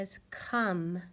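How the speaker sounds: "come" said kum, short u; come like cum. Shown as a demonstration. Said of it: "not comb like you would think".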